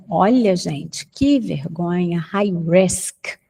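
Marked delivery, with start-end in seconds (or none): none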